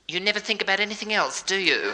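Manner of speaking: put-on high girl in the back seat; mock girl's voice. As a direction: pitch it up as the girl chiding her fella. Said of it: "she's saying to him".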